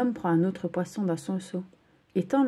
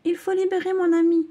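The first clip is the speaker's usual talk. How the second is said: high, louder desperate plea; desperate shout; 11 semitones higher, 7 dB louder than usual. Acted. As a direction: shout for your captured friend. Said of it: "crie Océane". (cri désespéré, aigu et fort)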